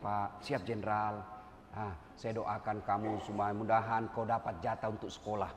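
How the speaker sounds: low, soft friendly voice; kindly greeting. Retold or imitated. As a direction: greet them gently. Officer greeting subordinate kindly.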